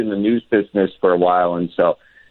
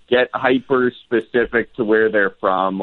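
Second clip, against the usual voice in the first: ordinary talk, nothing marked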